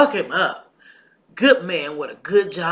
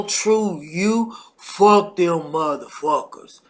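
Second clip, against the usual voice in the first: ordinary delivery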